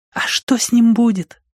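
put-on high-pitched child's question; anxious small boy. A narrator's reading. Small boy asks his older friend about a punishment, anxious. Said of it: "прошептал Пузырёк".

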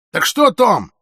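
loud, high, impatient prompt. A narrator's reading. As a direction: push for an answer, patience gone. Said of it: "не выдержал он". (громко, теряя терпение)

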